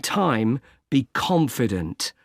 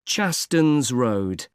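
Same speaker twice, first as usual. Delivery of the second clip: Chastons Road said slowly and distinctly; slow, careful repeat; much slower than usual. Acted. Agent repeating the street name for a caller, slow and careful. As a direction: repeat it slowly so it can be caught.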